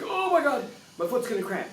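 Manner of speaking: high, loud panicked squeal; mock panic. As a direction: shriek it like the cramp is already here. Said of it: "your mind's like".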